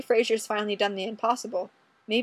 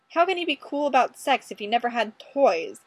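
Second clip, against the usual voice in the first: ordinary delivery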